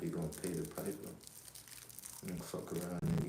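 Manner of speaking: usual speaking voice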